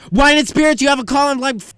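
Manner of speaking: loud, high intercom announcement; intercom page. Retold or imitated. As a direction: page over the store speaker.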